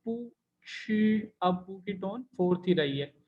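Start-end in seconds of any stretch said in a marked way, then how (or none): 0.0-1.3 pū shì drawn out, slow and clear; tone demo. Shown as a demonstration. Teacher to students, demonstrating Chinese tones slowly. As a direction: sound out पू शी slowly with fourth tone kept. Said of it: "पू के बाद first tone आएगी".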